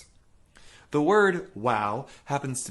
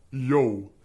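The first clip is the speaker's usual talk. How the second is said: dark dopey voice; 6 dB louder than usual. Shown as a demonstration. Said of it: "Certain sounds help us lower the larynx".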